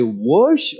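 "whoosh" as a regional way of saying wash, rounded oo vowel; other dialect's "wash". Shown as a demonstration. Showing how somebody else says wash, regional pronunciation. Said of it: "somebody else will say".

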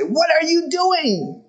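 high, slow mock scolding; scolding the dog. To his dog eating vomit, high slow mock scolding. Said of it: "you just wanna say".

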